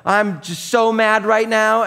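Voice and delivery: slow, high, loud mock rage; hammed-up anger at the car; seething, slowed-down mock fury; mock furious rant. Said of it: "And I'm like".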